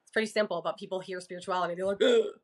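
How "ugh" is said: a loud, scornful groan of disgust; mock groan, dismissing the word; imitating someone's disgust; disgusted ugh. Mock reaction to spirituality.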